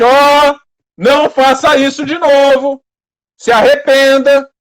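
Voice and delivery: loud, high voice of God rebuking; stern roaring warning. Acted. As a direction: thunder it out as a harsh warning. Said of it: "Ele fala duro". (bronca dura, em voz de trovão)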